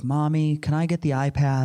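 a hint of a small child asking, lightly voiced; small child's question, lightly imitated; little child's voice. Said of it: "I hear this little voice".

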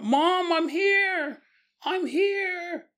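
high calling across the field; yelling out. Retold or imitated. Son calling to his mother across the field, yelling high. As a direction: call out to be found. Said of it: "then yells out".

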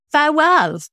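put-on high, loud child's farewell; imitating a child. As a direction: chirp it like a little girl on a ride. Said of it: "and she went".

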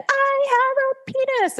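put-on high, childlike proud declaration; little brother's proud announcement. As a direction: squeak it out like a proud four-year-old. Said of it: "my brother stood up on a chair and he said".

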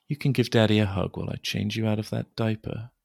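ordinary talk

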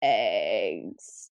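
very low, drawn-out creepy croak. Retold or imitated. Tall thing behind her in a dream, creepy voice. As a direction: imitate a creepy creature, deep and slow. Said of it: "said with this, like, creepy voice".

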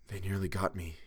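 soft, drained gasp; weak gasp. A narrator's reading. Chalmers recovering from his narrow escape, gasping softly. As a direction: barely get it out, gasping. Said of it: "he gasped".

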